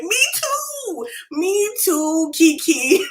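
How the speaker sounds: high, loud "same here" to a sister; excited retold agreement. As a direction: squeal it like you totally relate. Acting out her reply to sister.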